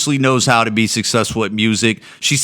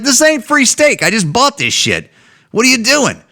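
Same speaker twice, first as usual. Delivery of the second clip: mock outrage; 10 semitones higher than usual. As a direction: squawk back in disbelief at the waiter. Customer protesting the free steak.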